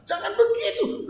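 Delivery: high, loud snapping scold at the wife; mock yelling husband. Husband scolding his wife, high and loud mock yell. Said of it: "ngomongnya bentak-bentak".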